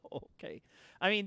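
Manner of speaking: ordinary delivery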